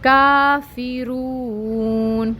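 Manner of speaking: کافرون very slow and sung; slow, melodic کافرون; recited kafirun. Shown as a demonstration. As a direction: chant کافرون out slowly. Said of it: "یہ بھی ٹو counts ہے".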